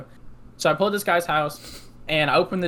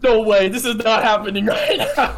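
high-pitched laughing disbelief; 4 semitones higher, 4 dB louder than usual. Retold or imitated. Driver stunned by customer's remark, laughing disbelief. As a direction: retell the shock through laughter.

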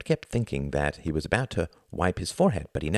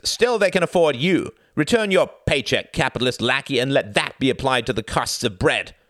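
loud, high heckling shout; angry cry; 8 semitones higher, 5 dB louder than usual. Acted. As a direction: shout down the lackey. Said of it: "cried a communist from the middle ranks".

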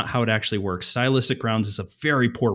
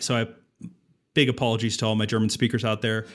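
ordinary delivery

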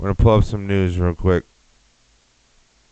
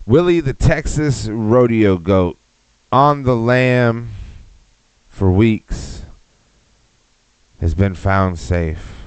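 slow dramatic headline read; slower than usual. Pretend.

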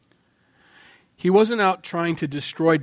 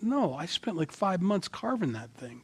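indignant carver; 5 semitones higher, 5 dB quieter than usual. Retold or imitated. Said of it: "Bob's gotta be over there saying".

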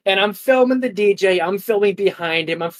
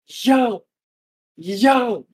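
higher-pitched mock call to friends; retold calling out; 5 semitones higher, much slower than usual. Guest retells hailing his group from VIP, mock calling out. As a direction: call down to your buddies, spaced out.

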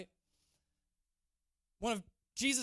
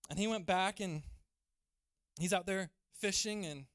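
normal talking voice

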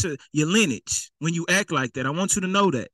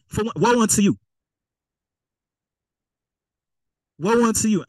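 raised, higher preaching cry; fiery preaching; 5 semitones higher than usual. Acted. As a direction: call out the sinners from the pulpit. Preacher rising to condemn officers.

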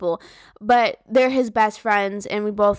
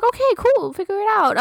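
mock high voice; 10 semitones higher than usual. Mocking boyfriend's overconfidence.

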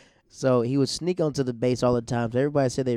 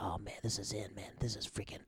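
hushed, unvoiced whisper; a secret excited whisper to a friend; whispered aside; 4 semitones lower, 14 dB quieter than usual. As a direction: whisper it so nobody in church hears. Whispering to friend during worship.

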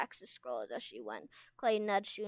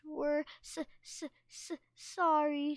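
stammered; 6 semitones higher than usual. A narrator's reading. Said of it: "Sunny stammered".

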